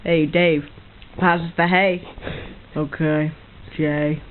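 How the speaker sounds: low, slow giraffe voice. Pretend. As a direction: talk like a dopey giraffe. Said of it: "do your giraffe impression".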